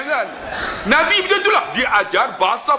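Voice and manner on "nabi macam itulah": ordinary talk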